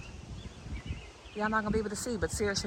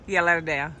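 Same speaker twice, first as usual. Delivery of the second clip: ordinary talk, nothing marked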